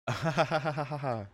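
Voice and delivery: high manic cackle; laughing like an idiot. Acted. Shiki unleashed against the beasts, high manic cackle. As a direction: burst into crazed laughter. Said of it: "I start to laugh like an idiot".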